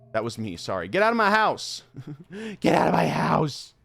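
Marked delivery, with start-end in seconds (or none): 0.9-1.8 loud, high shout at the thing; mock yell. Player yelling at the game creature, loud high shout. 2.6-3.7 loud shout in an altered voice; mock yell, voice changed. Player yelling at the creature again, loud altered shout.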